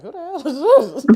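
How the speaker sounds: loud, high, laughing mock bafflement; laughing disbelief. Retelling her reply to a strange caller, laughing.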